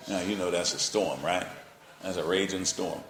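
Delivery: ordinary delivery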